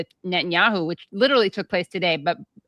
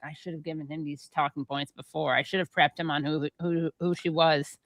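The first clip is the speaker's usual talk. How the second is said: quiet regretful muttering; 4 semitones lower, 6 dB quieter than usual. Retold or imitated. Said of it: "Dana being like".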